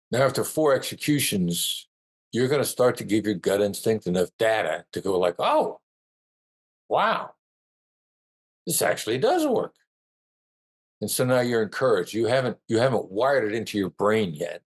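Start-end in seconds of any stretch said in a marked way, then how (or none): 5.4-9.7 high, amazed oh wow; pleasantly shocked that it works; surprised delight. Retold or imitated. Imagined gut reaction, surprise.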